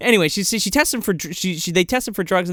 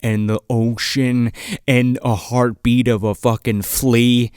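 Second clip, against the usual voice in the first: slow, low, dramatic wonder; slow lowered mock reverie; hammed-up sensory awe; 5 semitones lower, slower than usual. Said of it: "he's like".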